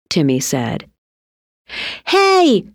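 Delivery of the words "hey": high calling-out shout. A narrator's reading. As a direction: call out sharply across the water. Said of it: "Tina shouted at the dress".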